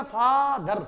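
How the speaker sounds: "फादर" with a long फा, then दर; split into syllables. Shown as a demonstration. Teacher showing the needed stop, "फादर" as फा-दर. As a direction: stretch फा in "फादर", then land on दर. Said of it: "रुकना पड़ता है ना".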